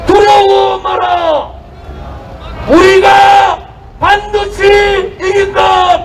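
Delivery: slow, high battle cry; rally the comrades, roar it; roaring rallying speech. Real, not acted.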